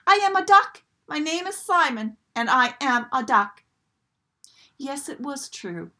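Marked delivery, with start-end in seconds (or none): 0.1-3.6 high, loud proud declaration; excited shout. A narrator's reading. Duckling proclaiming who he is, high and loud. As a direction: announce it proudly to the world. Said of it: "he yelled".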